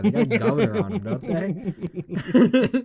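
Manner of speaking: high, laughing question. Real, not acted. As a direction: laugh the words out. Laughing at a silly story.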